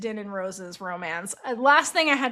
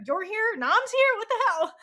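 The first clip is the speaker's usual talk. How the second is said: shocked outburst; 10 semitones higher than usual. Retold or imitated. Din startled by cousins showing up.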